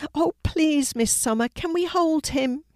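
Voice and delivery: put-on high-pitched child's plea; pleading. A narrator's reading. Vicky begs teacher to hold the hamster, pleading. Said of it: "pleaded Vicky".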